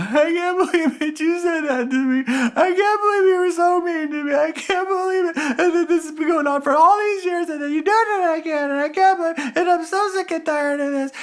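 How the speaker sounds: high, whining wounded-victim voice with a cry in it; whiny victim. Acted. Speaker mocking the powerless victim blaming others, high whine. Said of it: "as a victim".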